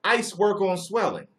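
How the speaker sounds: high, loud mock quote; mocking viewer. Streamer mimicking a chatter's know-it-all comment, mocking. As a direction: parrot him sarcastically. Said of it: "He told me, like".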